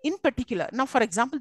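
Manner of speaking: ordinary talk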